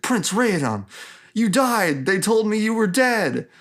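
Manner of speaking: high, melodramatic villain in disbelief; hammed-up movie bad guy, stunned; Marvel villain. Telri gasps at the returned prince, shocked Marvel villain. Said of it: "Real Marvel villain, ah, moment".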